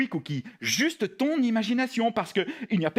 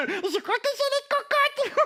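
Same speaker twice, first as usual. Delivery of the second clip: squealed high puppet guess; eager, mistaken puppet. Acted. Puppet blurts another wrong word.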